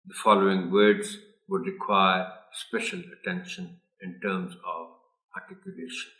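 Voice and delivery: usual speaking voice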